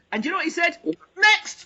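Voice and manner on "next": loud, high mock call; curt dismissal. Casting director to the waiting hopeful, curt shouted dismissal.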